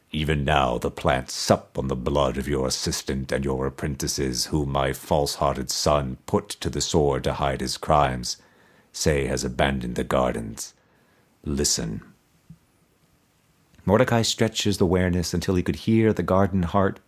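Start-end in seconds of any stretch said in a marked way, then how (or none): 0.1-12.0 low, soft, toneless voice of a buried skull; resonant toneless skull. A narrator's reading. Buried emperor's skull answering Mordecai, low resonant toneless voice. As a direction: intone it without any tune, deep in the earth. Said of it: "still wielded a voice of command".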